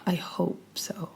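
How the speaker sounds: soft unvoiced whisper. Real, not acted. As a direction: breathe it as an aside. Whispered aside after claim.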